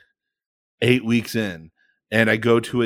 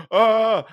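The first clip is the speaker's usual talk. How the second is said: high "ah" cry; mock panicked holler; retold bailout yell; 10 semitones higher, 4 dB louder than usual. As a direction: burst out with a raised-pitch yell. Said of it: "And I went".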